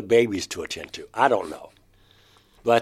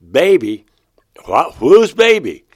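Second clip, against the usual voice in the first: retold shock, much higher and much louder; shocked questions; 6 semitones higher, 13 dB louder than usual. David hearing of a baby he didn't know, shocked. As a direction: blurt out the questions in alarm.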